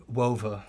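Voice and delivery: Wova, with a w sound where Rover has its r; says w for r. Retold or imitated. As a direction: say Wova like a child who can't make an r. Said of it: "I couldn't say my R's or my L's".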